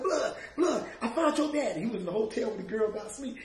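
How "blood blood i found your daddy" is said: higher and louder, laughing with joy; retold joyful call; excited news. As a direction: burst in with the great news. Calling out the good news.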